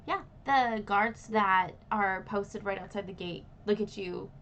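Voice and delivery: ordinary talk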